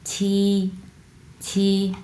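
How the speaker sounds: "ছি" held long and level; flat tone. Shown as a demonstration. Teacher repeating seven for students, flat tone. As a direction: keep "ছি" on one even note. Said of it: "flat tone".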